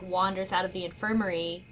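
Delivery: usual speaking voice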